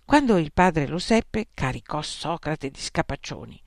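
usual speaking voice

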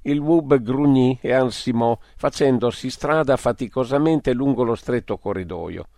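usual speaking voice

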